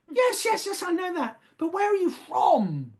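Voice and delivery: very high, quick "yes, yes, yes", then the question again; imitating Mother waving off his answer, up high and insistent. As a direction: brush the answer aside and press the question. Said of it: "Oh, um, I've just driven".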